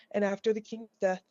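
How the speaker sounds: ordinary delivery, nothing marked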